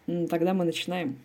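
ordinary delivery

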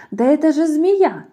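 loud, high exclamation; mouse crying out. A narrator's reading. Mouse spots the snake, loud high cry. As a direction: call out as a bold little mouse. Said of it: "мышонок закричал".

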